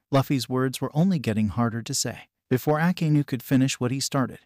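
ordinary delivery, nothing marked

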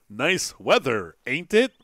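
mock stilted line without any Southern accent, slow and deliberate; over-enunciate it without a drawl, tag question lifted. Player mocking the game's voice actor, no Southern accent. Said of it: "there's an accent that goes with it. It's not like".

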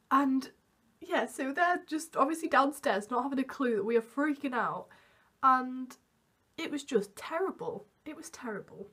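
normal talking voice

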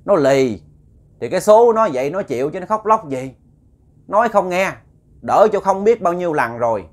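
loud, high scolding from the possessing spirit; possessed spirit scolding. Retold or imitated. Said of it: "bà nói". (hồn bà Bảy nhập xác, la mắng lớn tiếng)

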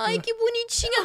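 high-pitched doting coo; imitated sweet cooing. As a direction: gush as if over someone adorable. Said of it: "Aí ela".

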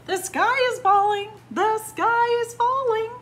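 very high, loud storybook squawk; chicken squawk. A narrator's reading. Chickens cry alarm to the farm animals, squawking. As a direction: squawk it like panicking hens. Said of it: "squawked the chickens".

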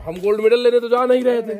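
insist, nearly shouting; loud insistence. Real, not acted. Friend loudly protesting that they are not racing for a gold medal. Repeats complaint much louder.

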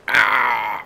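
sudden loud yelp; startled cry. Real, not acted. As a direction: cry out as you fall. Falls in the game.

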